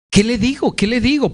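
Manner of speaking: high-pitched, urgent self-questioning; mock desperation. Acts out pleading for what to say.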